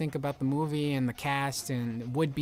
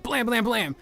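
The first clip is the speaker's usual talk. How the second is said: high, loud mock gun blasts; cartoon gunfire noise; imitated gunshots; 8 semitones higher, 5 dB louder, slower than usual. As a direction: spit out the shots like a kid playing. Imitated gunfire in his story.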